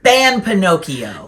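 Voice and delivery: slow, loud mock decree; mock proclamation. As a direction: declare it like a joking dictator. Mock ban declaration.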